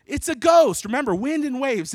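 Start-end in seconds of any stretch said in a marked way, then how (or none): 0.1-0.9 high, loud cry of alarm; terrified yell. Acted. Disciples to each other on seeing Jesus, terrified yell. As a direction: yell it out in terror over wind and waves. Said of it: "they were terrified and said".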